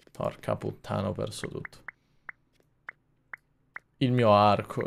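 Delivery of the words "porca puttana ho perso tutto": deflated. Real, not acted. Lost everything in game.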